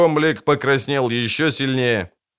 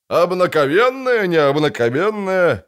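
chuckling; 7 semitones higher, 3 dB louder than usual. A narrator's reading. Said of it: "хохотнул толстяк".

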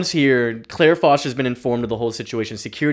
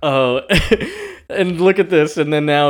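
usual speaking voice

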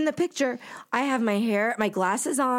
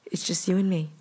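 retold soothing; 4 semitones lower than usual. Actor comforting a crying fan in his arms, soothing.

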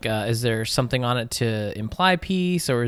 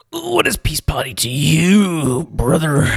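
announcer voice; 5 semitones higher, 5 dB louder, slower than usual. Acted. Slow announcer-style question.

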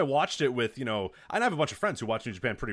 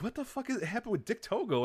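shocked; 9 semitones higher, 4 dB quieter than usual. Retold or imitated. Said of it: "I'm like".

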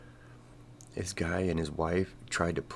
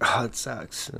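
mock dismay; 6 semitones higher, 5 dB louder, slower than usual. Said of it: "you're like".

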